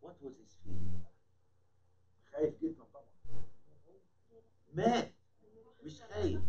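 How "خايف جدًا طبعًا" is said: ordinary talk, nothing marked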